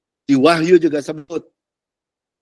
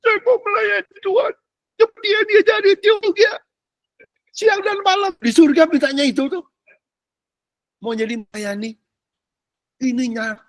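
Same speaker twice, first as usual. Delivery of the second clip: whiny believer. Retold or imitated. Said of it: "Terus ngomongnya gini".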